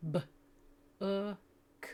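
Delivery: B clipped short, then u, k; clipped sounds. Shown as a demonstration. Teacher repeating the clipped sounds of book. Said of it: "You wanna clip the sound".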